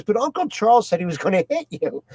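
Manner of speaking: a hint of the worried daughter. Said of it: "She goes".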